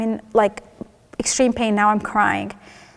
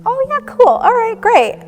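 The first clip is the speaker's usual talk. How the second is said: much higher, louder chirpy "cool" in disbelief; stunned false cheer; 13 semitones higher, 4 dB louder than usual. Retold or imitated. Hearing about the tumors from the doctors, shocked chirpy brightness. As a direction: chirp along brightly, not taking it in. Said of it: "I have no idea what's going on".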